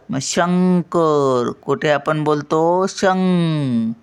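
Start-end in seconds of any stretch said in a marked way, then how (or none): 0.2-1.5 "शंकर." slow, with a long "ng" in "शं"; ङ चा उच्चार. Shown as a demonstration. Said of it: "ngचा उच्चार येतो". 2.9-3.9 "शं-," held on a humming "ng"; ङ चा उच्चार. Shown as a demonstration. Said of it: "ngचा उच्चार येतो".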